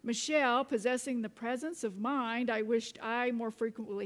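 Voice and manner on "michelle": ordinary talk, nothing marked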